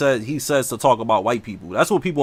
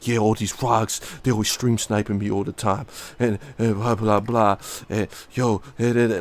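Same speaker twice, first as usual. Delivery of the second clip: mock whispery streamer; 4 semitones lower, 4 dB quieter than usual. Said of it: "this dude whispering".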